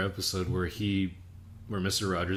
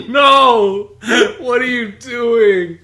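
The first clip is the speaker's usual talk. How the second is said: high, loud, laughing protest; mock outraged yell; 12 semitones higher, 13 dB louder, much slower than usual. Yelling at the stream chat in mock horror. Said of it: "I'm like".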